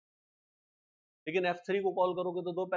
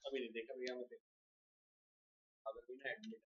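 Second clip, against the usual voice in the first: much softer, lower side conversation; quiet phone aside; 7 semitones lower, 16 dB quieter than usual. Real, not acted. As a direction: talk low into the phone, away from the class. Takes a phone call mid-lesson.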